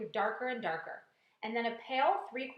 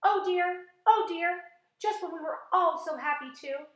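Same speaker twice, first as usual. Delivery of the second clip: despairing wail; 8 semitones higher, 8 dB louder than usual. A narrator's reading. Ladybug in despair.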